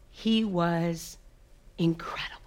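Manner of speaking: breathy hush of being in love; dreamy whisper. Real, not acted. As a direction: whisper it as if still enchanted. Falling in love, dreamy memory.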